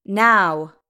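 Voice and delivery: loud, one-word order; sharp command. A narrator's reading. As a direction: command it at full voice. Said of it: "she commanded".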